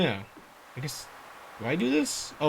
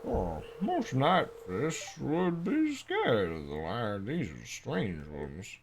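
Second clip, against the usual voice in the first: low character voice; 9 semitones lower than usual. Acted.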